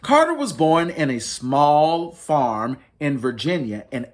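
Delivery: lightly lifted storybook read-aloud; reading to children. Acted. Librarian reading to families, mild storytelling voice. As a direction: tell it gently like a picture book. Reads picture book aloud.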